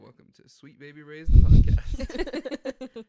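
booming, stretched-out announcer. Pretend. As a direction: drawl the show name like a host. Hammed-up fake podcast intro.

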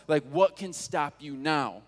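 normal talking voice

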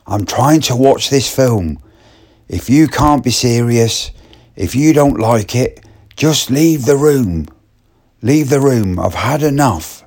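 angry telling-off. Retold or imitated. Young woman losing patience with Martin, irate and loud. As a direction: order him out of the room. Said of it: "but it was really loud".

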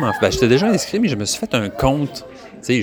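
usual speaking voice

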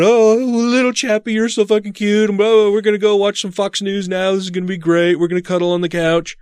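high sing-song coo to the puppy; baby talk to puppy. Retold or imitated. Cooing to puppy while bouncing.

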